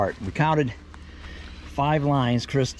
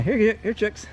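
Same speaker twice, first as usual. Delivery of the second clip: calling chickens; 7 semitones higher than usual. Real, not acted.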